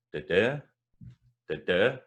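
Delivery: last da punched; stress at the end. Shown as a demonstration. Tutor chanting the end-stressed Dundee. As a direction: lean onto the final beat. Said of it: "the stress at the end".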